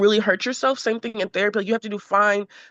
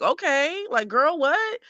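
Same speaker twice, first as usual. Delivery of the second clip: sarcastic comeback; 7 semitones higher, slower than usual. Retold or imitated. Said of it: "I'll get sarcastic back".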